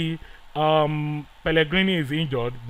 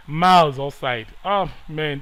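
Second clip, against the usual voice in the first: ordinary delivery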